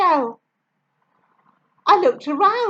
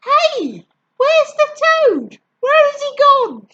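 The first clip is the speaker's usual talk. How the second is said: high, louder, baffled shout; bewildered cry; 9 semitones higher, 5 dB louder than usual. A narrator's reading. Frenchmen with knives lose the toad, a high baffled cry. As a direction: yell it out, searching everywhere. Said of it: "the Frenchmen with the knives cried".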